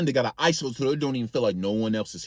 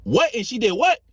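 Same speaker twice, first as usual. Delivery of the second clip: high, loud stunned question; can't believe what she heard; disbelief; 8 semitones higher, 4 dB louder than usual. Retold or imitated. Auntie stunned by the cousin's story.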